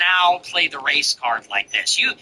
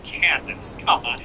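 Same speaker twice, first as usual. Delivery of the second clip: ordinary delivery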